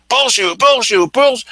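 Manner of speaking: high, repeated mock yell; insistent high-pitched retold yelling; imitated screaming. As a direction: imitate a heckler drowning someone out. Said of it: "yelling and screaming".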